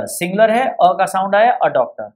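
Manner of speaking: ordinary talk, nothing marked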